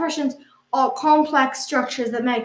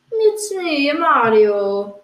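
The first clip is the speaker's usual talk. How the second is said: Mario; 2 semitones higher, 4 dB louder, much slower than usual. Acted. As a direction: do an Italian person imitating the game hero. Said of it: "impression of an Italian person doing an impression of Mario".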